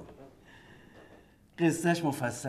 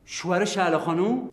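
louder, higher astonished question; shocked question; 7 semitones higher, 6 dB louder than usual. Acted. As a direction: react in disbelief. Shocked at the husband's identity. (سؤال با تعجب و صدای بلند)

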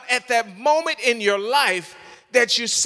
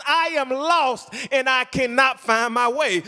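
impassioned pleading, high-pitched and insistent; fervent preaching; 4 semitones higher than usual. Acted. Preacher voicing a struggling believer's plea to God, fervent preaching. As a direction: pile up each plea in a driving preacher's cadence.

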